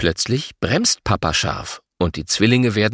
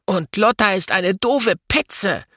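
angry; 11 semitones higher than usual. A narrator's reading. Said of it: "Jetzt ist Louis wütend".

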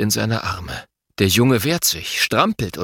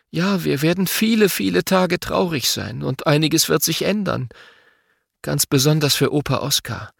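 understanding mother; 8 semitones higher than usual. A narrator's reading. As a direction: comfort the children but stay firm. Mother voiced in story.

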